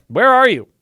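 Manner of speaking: God calling loudly and high, searching. Acted. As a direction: shout it across the garden. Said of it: "the Lord God called to the man".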